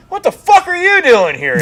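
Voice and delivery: loud, very high retold shout; surprised greeting yell. Retelling friend's greeting at a surprise visit, startled shout. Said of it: "He's like".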